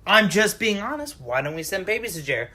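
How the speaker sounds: ordinary talk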